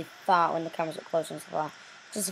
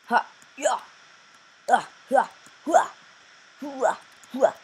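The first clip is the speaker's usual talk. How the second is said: tennis grunt; 6 semitones higher, 4 dB louder than usual. Acted. Effort noises climbing in game.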